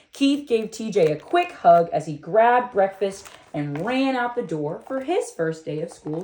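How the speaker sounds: ordinary delivery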